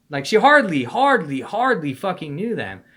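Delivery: "hardly" shouted high and loud, repeated; exasperated burst on "hardly"; stress on hardly. Acted.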